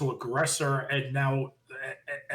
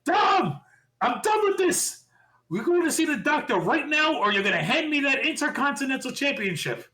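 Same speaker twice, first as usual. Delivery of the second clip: Adam Pearce yelling; 10 semitones higher than usual. Retold or imitated. Podcaster imitating an official calling out Dom backstage, high-pitched yelling. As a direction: holler the name, then rant on at high pitch. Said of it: "you hear Adam Pearce yell".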